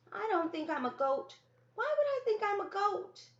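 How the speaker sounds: put-on high, loud, indignant child's whine; a hurt boy protesting, pitch climbing; upset little brother. Fallen brother protests to his sister, high and whiny.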